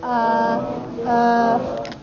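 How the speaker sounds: UH held long, low and level; low hesitating tone. Shown as a demonstration. Teacher shows half third tone, low hesitating sound. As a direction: stretch UH like a hesitation, staying down. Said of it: "that's a half third tone".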